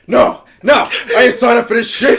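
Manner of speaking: high, loud mock protest; indignant shouted imitation; outraged refusal. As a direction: imitate a big guy loudly refusing. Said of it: "this dude immediately goes".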